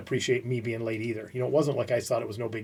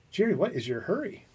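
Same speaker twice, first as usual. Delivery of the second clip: imitated boss, higher-pitched unworried question; deceptively calm; 6 semitones higher than usual. Boss questioning a late young employee, deceptively calm. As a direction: play the boss asking evenly, anger withheld. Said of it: "he's, like, serious, just, like, not worried".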